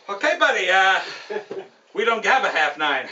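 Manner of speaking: cocky wise-guy kiosk man; loud, brash mobster-style clerk; Joe Pesci. Acted. Ferry kiosk man turning away a driver, cocky. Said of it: "kind of the cocky Joe Pesci guy".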